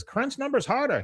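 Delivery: high-pitched retold wife voice; Min. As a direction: order it in a high cartoon voice. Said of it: "Min says".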